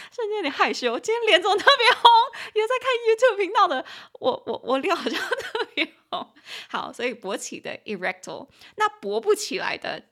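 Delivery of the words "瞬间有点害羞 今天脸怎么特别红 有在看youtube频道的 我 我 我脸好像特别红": high, loud, giggly fluster; real bashful outburst, laughing and loud; embarrassed. Real, not acted. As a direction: squeal with laughter as you blush.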